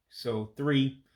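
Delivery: ordinary talk, nothing marked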